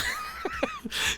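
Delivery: short high laugh; amused laugh. Real, not acted. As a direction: burst out in a high, soft giggle.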